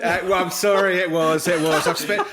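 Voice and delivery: high, loud laughing reply; laughing embarrassed. Real, not acted. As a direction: laugh it off, flustered. Laughing, embarrassed at being caught.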